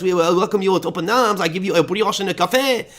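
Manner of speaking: exaggerated French accent, climbing high in pitch; mock French welcome, accent laid on thick and high. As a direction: invite them over in a hammed-up French accent. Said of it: "do it in a French accent".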